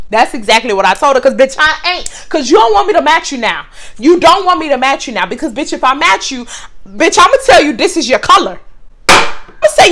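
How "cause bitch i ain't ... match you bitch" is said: usual speaking voice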